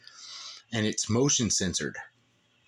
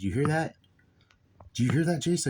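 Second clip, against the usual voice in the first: retold alarm; 6 semitones higher than usual. Alarmed question to his son, retold.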